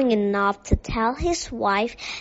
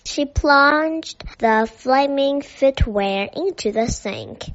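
ordinary delivery, nothing marked

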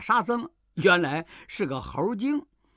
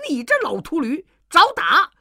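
high, loud angry yell rising to a shout; furious monkey; 10 semitones higher, 6 dB louder than usual. A narrator's reading. Fake monkey king yells at master before striking, furious high shout. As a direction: snarl then bellow the threat. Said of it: "行者大怒".

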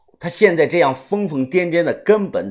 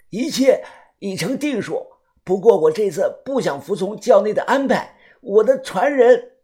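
gruff man's voice; 6 semitones higher than usual. Acted. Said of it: "学着用男人的语气粗声粗气地说道".

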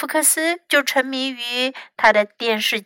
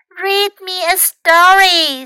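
high, loud little-one voice; small child's voice; 7 semitones higher, 5 dB louder, slower than usual. A narrator's reading. Hurt Louis orders Fox about, high small voice. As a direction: make demands in a high small voice.

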